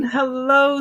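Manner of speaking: ordinary delivery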